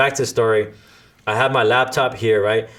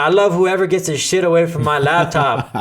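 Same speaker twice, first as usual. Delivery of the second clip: louder, higher exasperated comeback; retold exasperated retort; 6 semitones higher, 4 dB louder than usual. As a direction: snap back half-joking. Retorting to the drunk pair.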